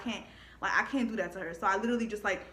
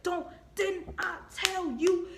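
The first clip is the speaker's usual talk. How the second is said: parent scolding; 7 semitones higher, much slower than usual. Retold or imitated. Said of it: "they be like".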